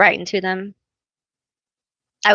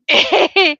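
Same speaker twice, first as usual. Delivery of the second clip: high laugh; 12 semitones higher, 5 dB louder than usual. Real, not acted. Laughing at own confession.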